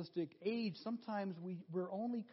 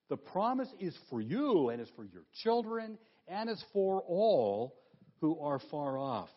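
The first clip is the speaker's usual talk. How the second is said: for all said louder, lifted and held; stress on all; 4 dB louder than usual. Shown as a demonstration. Preacher drives home who the promise is for, stressing all. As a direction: punch the word all. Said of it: "He says what?".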